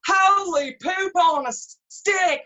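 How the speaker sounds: slow, high, loud mock jeer; mock insult. Cheeky kid jeering at the French teacher, slow loud and high. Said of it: "he looks at her and he goes".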